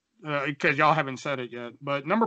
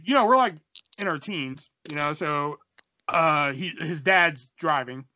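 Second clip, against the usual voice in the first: ordinary talk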